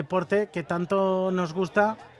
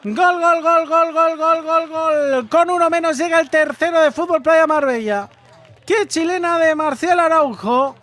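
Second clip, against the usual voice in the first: breakneck goal commentary; 8 semitones higher, 8 dB louder than usual. Real, not acted. Goal scored, commentator erupts.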